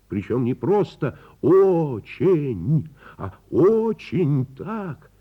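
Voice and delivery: очень stretched high and loud; очень, long and high; emphatic очень. Acted. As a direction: lean hard on every очень.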